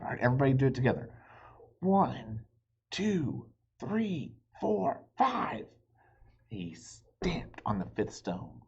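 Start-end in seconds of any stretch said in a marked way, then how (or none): 0.0-1.1 fast cheering. Acted. Reader prompts listeners to count. 1.8-5.7 building count. Acted. Counting stones together aloud.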